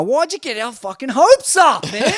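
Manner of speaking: high-pitched, pleading protest; mock exasperation. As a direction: plead like a let-down fan. Mock outrage at surfer's withdrawal.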